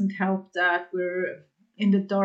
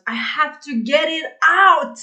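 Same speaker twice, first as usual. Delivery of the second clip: loud, much higher yelled cry, retold; mock scream; 8 semitones higher, 9 dB louder than usual. Retelling screaming alone in her car while driving home, a loud high mock yell. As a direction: belt it out like venting alone in the car. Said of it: "screaming like a maniac in my car".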